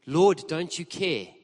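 ironic repetition, Lord drawn out; slow, pointed Lord. Shown as a demonstration. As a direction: let the irony sink in, slowly. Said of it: "This is the God of the universe".

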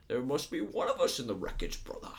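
put-on villain voice with a swooping rise and fall; theatrical masked-villain impression; Bane impression. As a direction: imitate Bane, pitch climbing high then dropping away. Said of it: "you get to hear his voice".

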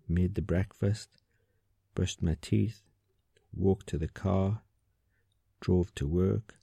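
short phrases at an even, unhurried beat with a pause after each; steady rhythm. Shown as a demonstration. Meditation teacher showing how to scan through the day, evenly paced. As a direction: tick through the list in a calm, regular rhythm. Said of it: "it might be something like this".

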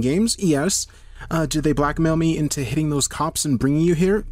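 high-pitched, exasperated rant; agitated retort. Acted. Melissa snaps back in an argument.

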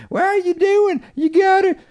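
put-on very high squeaky eleven-year-old gamer; mock a whiny kid yelling at his healer. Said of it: "eleven-year-old squeaky voice".

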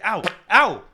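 loud high yelps of pain. Real, not acted. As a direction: yelp as the hair is yanked. Beard hair plucked.

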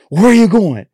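loud, high mock bark of a cop; real aggressive. Cop to pulled-over driver, aggressive mock shout. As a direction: demand it like an aggressive traffic cop. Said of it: "Like, real aggressive".

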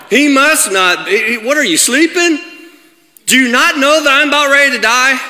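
high, loud, indignant outcry; acted panic. Person in a storm of life to God, acted panicked protest. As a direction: cry out in alarm at a sleeping God. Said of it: "where we feel the same way".

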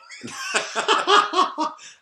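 loud, high belly laugh; burst of laughter. Real, not acted. (högt gapskratt)

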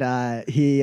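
ordinary delivery

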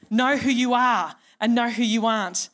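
normal talking voice

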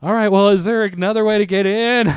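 mock-exasperated question to the landlord. Retold plea to landlord when locked out.

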